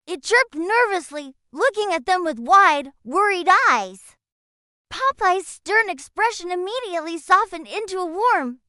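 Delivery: plain reading voice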